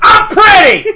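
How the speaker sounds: very high, loud laughing shout. Acted. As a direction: yell it, hamming up the diva. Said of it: "you just blew out the mic".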